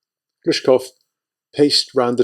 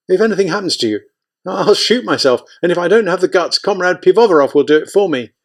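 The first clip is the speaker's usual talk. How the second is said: higher, pleading fast and scared; panicky pleading; 6 semitones higher than usual. Acted. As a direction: beg him not to risk it, voice climbing.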